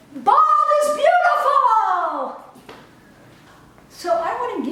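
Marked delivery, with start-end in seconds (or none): 0.1-2.4 high, loud, slow ringing proclamation; triumphant declaration again. Real, not acted. Closing her message to the audience, a proud ringing shout. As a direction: shout it proudly to the whole world. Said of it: "I want to say one more time".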